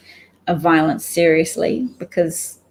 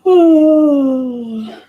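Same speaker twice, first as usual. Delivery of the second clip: a heavy, drawn-out exasperated sigh, higher and louder; long loud sigh; 8 semitones higher, 8 dB louder than usual. Real, not acted. Tired of the news, she heaves a loud sigh. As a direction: let out one long weary sigh.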